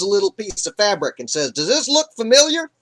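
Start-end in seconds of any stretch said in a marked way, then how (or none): 1.5-2.7 taunting. Acted. David taunts Saul with fabric.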